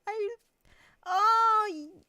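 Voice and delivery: high, loud, tearful excitement; overwhelmed. Real, not acted. Streamer overwhelmed by a viewer's offer, loud and squealing. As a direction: gush and squeal in disbelief.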